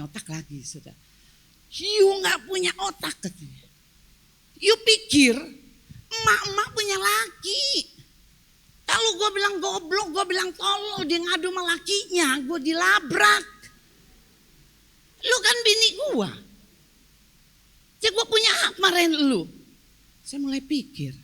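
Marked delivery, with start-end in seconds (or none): 1.7-3.2 higher-pitched retold scolding; mock angry insult. Husband berating his wife, acted indignation. 4.6-19.5 high-pitched, emphatic husband's rant; acted indignant scolding. Husband justifying scolding his own wife, indignant.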